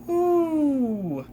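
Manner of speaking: high, falling "whoo"; real drawn-out sound of disbelief; overwhelmed whoo. Real, not acted. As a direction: let out a long exasperated whoo. Overwhelmed reviewer lets out breath.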